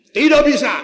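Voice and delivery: loud, high stern no; the teacher shouting down his pupil; angry refusal. Acted. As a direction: slam the door on the idea.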